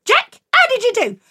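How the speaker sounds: high, loud, bright mum asking; sweetly expectant mum. Acted. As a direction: mum greeting Jack at the door. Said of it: "And she said".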